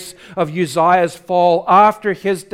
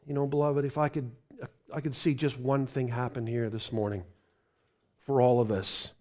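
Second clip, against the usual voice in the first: tender, sincere wish; 6 semitones lower, 13 dB quieter than usual. Real, not acted. Pastor sharing his hope with the congregation, tender and soft. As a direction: speak it close, from the heart.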